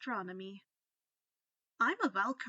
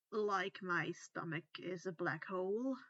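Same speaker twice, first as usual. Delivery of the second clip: sighing; 5 semitones lower, 3 dB quieter than usual. Acted. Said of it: "he sighed".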